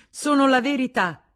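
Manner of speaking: impatient wife, high and loud. Acted. Wife insisting to her husband, impatient. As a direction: snap back, fed up. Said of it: "disse Madame Arnoux spazientita".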